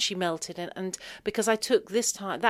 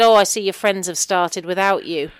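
louder sparky mum quip; mum's quip; 9 dB louder than usual. Retold or imitated. Sparky mum joking to her daughter, louder. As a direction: fire the joke back quick. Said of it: "she said".

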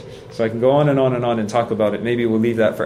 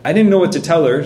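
usual speaking voice

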